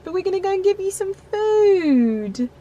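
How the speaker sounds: high, bright coo to the dog; high pet voice promising food; pet talk. Real, not acted. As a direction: sing it to your pet. Talking to her dog.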